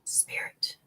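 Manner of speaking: breathy whisper to the spirit; whispered call. Real, not acted. Medium summoning the spirit before a reading, whispered. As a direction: hush it like summoning something.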